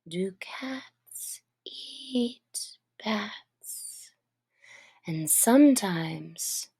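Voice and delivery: slow, sleepy whispered musing; a drowsy, half-asleep whisper; dreamy whisper. A narrator's reading. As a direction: drift off while whispering it. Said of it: "in a dreamy sort of way".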